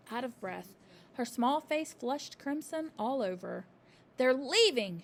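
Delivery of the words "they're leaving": loud breathless cry; a child blurting news. A narrator's reading. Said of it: "she cried".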